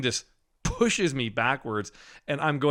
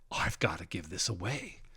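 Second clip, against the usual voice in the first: whispered, much softer thought; inner thought whisper; 5 semitones lower, 9 dB quieter than usual. Retold or imitated. Thinking silently during prayer, whispered. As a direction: whisper it to yourself.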